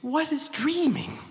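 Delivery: a newborn asking its maker; innocent snowman. Acted.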